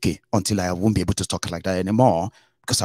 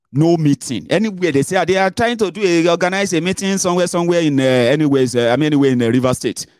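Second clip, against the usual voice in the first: excited high telling; 8 semitones higher, 4 dB louder than usual. Real, not acted. Describing fear in Rivers.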